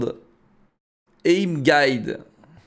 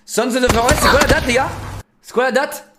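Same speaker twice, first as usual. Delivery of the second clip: eager question; 9 semitones higher than usual. Real, not acted. Calling out to chat.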